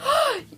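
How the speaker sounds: sharp high mock gasp. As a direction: imitate a surprised intake of breath. Retelling Jay seeing her top.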